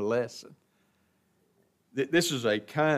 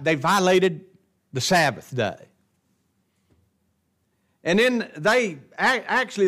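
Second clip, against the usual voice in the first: higher, louder preacher's build; full-force preaching; 7 semitones higher, 6 dB louder than usual. Acted. Preacher to congregation about Pharisees, rising fervent preaching.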